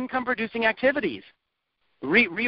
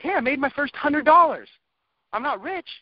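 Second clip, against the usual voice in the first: excited first sale; 6 semitones higher than usual. Retold or imitated. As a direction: burst out with joy at the first money. Imagined first sale delight.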